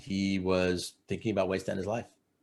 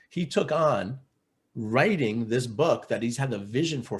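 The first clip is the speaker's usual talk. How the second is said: usual speaking voice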